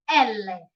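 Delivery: "L" said elle, in two syllables; Italian L. Shown as a demonstration. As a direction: model "L" as EL-leh. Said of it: "but the pronunciation".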